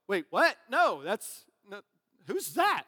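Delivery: loud, startled double take; mock disbelief. Friend hears so-and-so got married, acting incredulous. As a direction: react baffled, voice jumping up by the end. Reacting to surprise wedding gossip.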